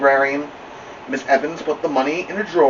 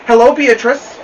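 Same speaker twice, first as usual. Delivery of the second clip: bright, higher and louder librarian greeting; friendly librarian voice; 12 semitones higher, 9 dB louder than usual. Acted. Kind librarian greeting a girl at her desk, bright and raised.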